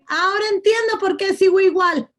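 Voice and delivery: exclamación aguda de quien por fin entiende; revelación repentina. Acted. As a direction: caer en la cuenta, con voz bien alta. Voz del oyente que se da cuenta. (como descubriendo algo de golpe)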